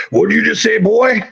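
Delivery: imitating a hostile older golfer; menacing old man. Older man confronting him.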